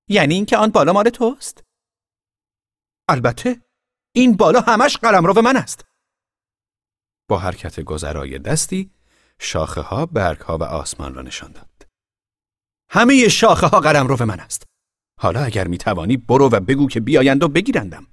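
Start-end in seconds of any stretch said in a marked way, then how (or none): 0.1-1.6 very high, loud questioning; incredulous girl. A narrator's reading. Girl questioning the boy's claim, incredulous. 3.1-5.8 put-on very high, loud proud boy; proud proclamation. A narrator's reading. Boy proclaiming the treetops his realm. 12.9-18.1 high, loud challenging boast; bragging boy. A narrator's reading. Boy daring the girl to send people after him.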